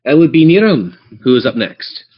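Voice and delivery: ordinary talk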